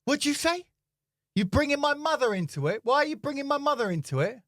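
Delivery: high, mock outraged demanding; mock angry. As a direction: imitate a big man's indignant challenge. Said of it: "he just gets really angry".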